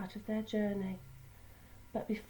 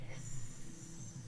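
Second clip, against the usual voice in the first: a breathy steam hiss, no voice; soft hiss; 9 dB quieter than usual. Shown as a demonstration. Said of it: "The hiss came again".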